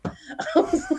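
words broken by a laugh; laughing through it. Real, not acted. Laughing at her own summer binge.

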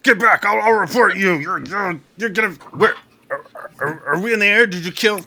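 high, panicked blustering; sputtering foiled villain. Acted. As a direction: stammer threats while scared. GM voices panicked captive dragonborn.

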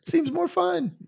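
high sing-song yell up to them; playful high-pitched shout; mock call. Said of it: "We were like".

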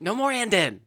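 high mock outburst from the movie; movie bit quote. Answering co-host's movie bit, high mock outburst. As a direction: cut in high with the punchline. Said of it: "they wouldn't have been able to do the "and then" part".

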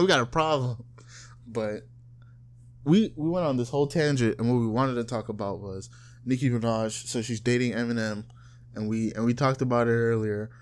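ordinary talk, nothing marked

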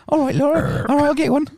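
very high, loud put-on voice; mock henpecked reply. Podcaster giving in to Laura's beer order, high mock-submissive voice. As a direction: squeal a submissive agreement.

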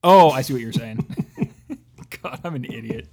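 ordinary delivery

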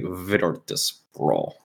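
VIRTUS PRO very slow and low, mimicking someone's odd delivery; imitated odd pronunciation. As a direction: stretch "Virtus Pro" into a soft "Vitortis Prol". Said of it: "that guy at Epicenter said that".

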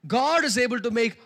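slow, loud, ringing preacher proclamation; preaching at full force. Shown as a demonstration. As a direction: stretch the verse out loud like a pulpit cry. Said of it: "Let's do it one more time".

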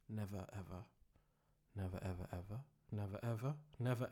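very soft, low repetition; barely audible, deep and solemn; hushed mantra. Acted. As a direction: murmur it like a secret incantation. Mock mantra drops to hushed repetition.

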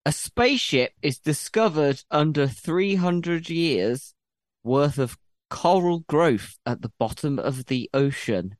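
slow, deliberate reading aloud; slow school dictation. Shown as a demonstration. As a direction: read each word out clearly like a teacher. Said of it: "I will read it again".

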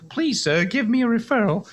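put-on high, pleading English orphan-boy accent; bad English accent. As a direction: beg like a hungry waif in a hammy English accent. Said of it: "[name], that's a terrible accent".

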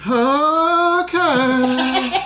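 very high, drawn-out oddball reply; Nick Cage doing Emo Phillips. Retold or imitated. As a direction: stretch it out in a weird high voice. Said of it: "an impersonation of Emo Phillips".

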